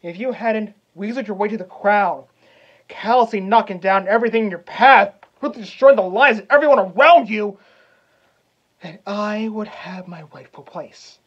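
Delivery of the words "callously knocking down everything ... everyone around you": loud, heated accusation; angry accusation. Acted. Hamlet accuses his usurping uncle, angry and loud. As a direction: hurl the charges at him, voice raised.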